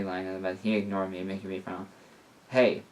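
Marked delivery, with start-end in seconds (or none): none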